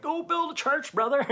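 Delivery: high, booming mock preacher; lightly mock a pumped-up pastor; hammed-up mega pastor. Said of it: "I thought he was gonna be like".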